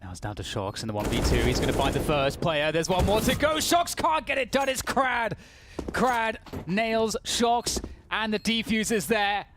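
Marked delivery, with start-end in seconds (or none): 1.4-9.5 building to a high, excited call; climactic play-by-play. Real, not acted. Caster calls Krad winning the round, climactic play-by-play. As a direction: ride the clutch up to its peak.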